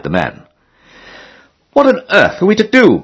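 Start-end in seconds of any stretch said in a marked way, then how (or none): 1.7-3.0 high-pitched exclaimed question; crying out. A narrator's reading. Harris with no inn left to try, crying out. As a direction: cry it out in narrated dismay, pitched well up. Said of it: "cried Harris".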